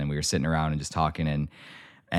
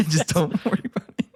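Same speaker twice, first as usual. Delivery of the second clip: high laughing parrot of the brush-off; laughing mock echo; 11 semitones higher than usual. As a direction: echo the line through a laugh, pitched up. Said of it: "repeats with a laugh".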